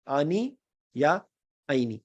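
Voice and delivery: ordinary talk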